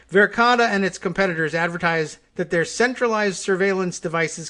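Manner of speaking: brisk, projected read-aloud delivery; news article read. Acted. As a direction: read it out like article copy, pitched a little up.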